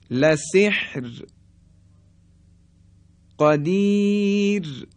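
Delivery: عسیر, قدیر, طیر stretched slowly, ending in a light ر; slow drawn pausal recitation; pausal thin R. Shown as a demonstration. Teacher recites pausal forms, slow and melodic.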